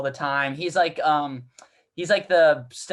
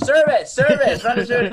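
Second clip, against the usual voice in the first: high, loud barked orders; chef yelling; 7 semitones higher, 8 dB louder than usual. Retold or imitated. The chef yelling at servers, high and loud. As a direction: hurry the servers along. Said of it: "the chef's like".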